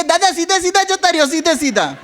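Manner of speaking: hint of a chirpy rickshaw driver. Rickshaw driver calling out directions to him.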